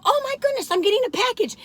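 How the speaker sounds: high excited squeal; excited about a package. Retold or imitated. Retelling her own thrill over a package, high and excited. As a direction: gush like a package just came. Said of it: "I'll go".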